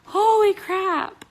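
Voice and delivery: high, teary voice breaking into laughs; overwhelmed, laughing through happy tears; crying with joy. Real, not acted. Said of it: "now I'm crying my eyes out".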